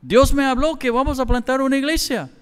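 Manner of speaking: excited. Retold or imitated. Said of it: "Y le dije".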